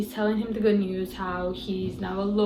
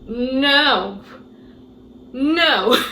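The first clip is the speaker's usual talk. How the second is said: loud, higher, laughing no; cracking up in shocked denial; laughing disbelief; 3 semitones higher, 6 dB louder than usual. Real, not acted. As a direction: refuse to believe it, laughing.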